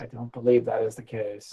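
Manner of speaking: whispered deadpan reply. Real, not acted. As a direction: whisper it dryly. GM whispered answer.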